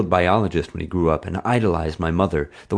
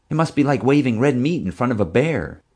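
higher-pitched, lightly voiced friend; eager young friend; 7 semitones higher than usual. A narrator's reading. Friend speculating to the narrator about sharks, eager. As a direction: push the idea eagerly, pitched up.

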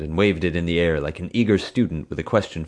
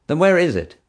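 higher, louder demand; 12 semitones higher, 7 dB louder than usual. Acted. Dad demanding an answer from Marge, loud and pointed. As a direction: press her hard for an answer. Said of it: "Dad demanded".

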